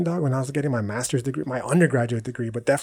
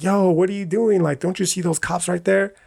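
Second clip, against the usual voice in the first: higher-pitched, acted-out protest; mock alarmed warning; 6 semitones higher than usual. [name] warns Tommy about nearby cops, mock alarm. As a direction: urgently check a reckless friend, lightly acted. Retold warning to reckless driver friend.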